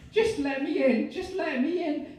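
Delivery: very high, loud begging; desperate pleading. Acted. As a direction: plead to be let in. Begging at the door.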